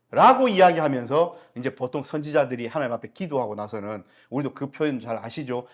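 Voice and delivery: ordinary talk, nothing marked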